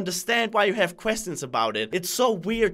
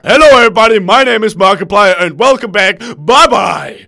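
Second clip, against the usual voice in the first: booming, very loud video-host intro; bad impression, shouted big; Markiplier; 14 dB louder than usual. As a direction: blast the greeting like a hammy announcer. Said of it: "I can even do Markiplier".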